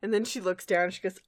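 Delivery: normal talking voice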